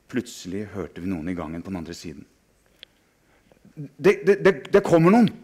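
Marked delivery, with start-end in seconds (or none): 3.8-5.3 panicked stammer. Acted. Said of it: "sa en gutt".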